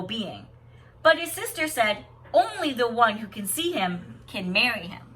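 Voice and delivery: lilting read-aloud storyteller voice; story narration. Acted. Teacher reading a picture book to students, lilting storybook voice.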